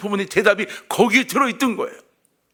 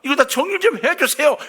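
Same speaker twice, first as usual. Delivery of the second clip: high, louder cry to God, half wailing; a hammed-up plea, pitched high; mock crying out; 9 semitones higher, 4 dB louder than usual. Augustine crying out to God, high and louder. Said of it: "이러고 막 울부짖고 있는 거예요".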